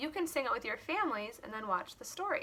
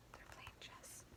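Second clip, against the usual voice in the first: whispered aside; 24 dB quieter than usual. Real, not acted. Quiet aside to kids.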